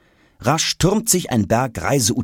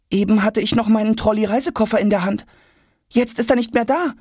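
put-on boy suddenly worried; faster than usual. A narrator's reading. Jonas missing case.

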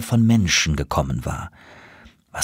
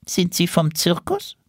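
much higher, wary squirrel voice; suspicious; 10 semitones higher than usual. A narrator's reading. Squirrel eyeing the colourful stranger, suspicious. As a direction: ask it with distrust. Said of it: "fragte es misstrauisch".

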